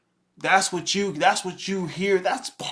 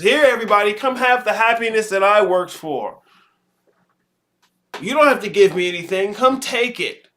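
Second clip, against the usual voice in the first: mock pushover; 5 semitones higher, 6 dB louder than usual. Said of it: "You just".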